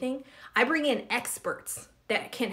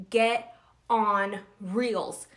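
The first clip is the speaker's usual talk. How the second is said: slow emphatic command; much slower than usual. Acted. Telling followers to use Reels: slow emphatic command. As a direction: stretch each word out: Get... on... Reels. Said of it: "repeated slogan, drawn out".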